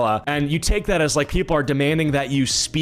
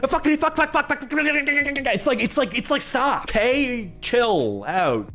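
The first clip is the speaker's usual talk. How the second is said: high mock frantic cursing; imitating her hammed-up outrage, high-pitched; performative outrage; 9 semitones higher, slower than usual. Host mocks the creator's outrage with high frantic cursing. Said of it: "the performative outrage".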